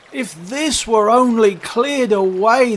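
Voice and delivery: high, slow, drawn-out lament; walrus and carpenter wishing. Acted. Both companions lamenting all the sand, high and wistful. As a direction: wish aloud, slowly and loudly.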